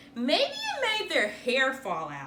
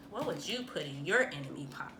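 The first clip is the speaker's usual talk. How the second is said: fast, secretive whisper to the listeners; whispered aside; 8 semitones lower, 8 dB quieter, faster than usual. Real, not acted. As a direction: lean in and whisper the question quickly.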